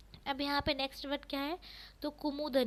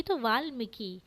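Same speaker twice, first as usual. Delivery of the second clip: normal talking voice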